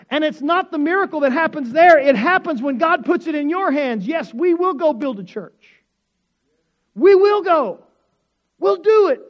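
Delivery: loud, high, rising proclamation; preaching at full force. Real, not acted. Pastor rallying the congregation, building to loud declaration. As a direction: build to a shout of resolve.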